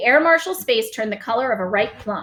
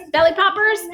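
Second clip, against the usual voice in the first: puzzled; 11 semitones higher, 5 dB louder than usual. Acted. BFG puzzled question.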